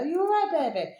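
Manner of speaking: real doting pet voice to Maisie; doting pet talk. Real, not acted. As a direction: coo at your tiny dog. Talks to her pet Maisie.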